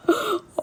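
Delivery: high, sharp gasp of dread; mock shocked gasp. Hearing that mom was already called, mock gasp. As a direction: suck in air as if horrified.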